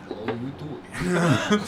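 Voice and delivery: talking fish with a mock Russian accent; laughing through a rough Russian accent. Game master voices a fish greeting two visitors, mock Russian accent. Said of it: "the fish are Russian".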